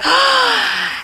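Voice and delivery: high, exaggerated gasp of excitement; mock an awestruck bagel fan's gasp; excited gasp. Imitating someone raving about Yeasty Boys, excited high gasp.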